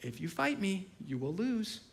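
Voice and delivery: put-on softly lilting, nice little voice for a child; soft parental sing-song warning; sweet sing-song. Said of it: "nice little sweet sing-song voice".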